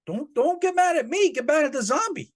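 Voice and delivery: squeaky mock-innocent whine; whiny excuse. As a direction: beg off the blame like a pouting kid.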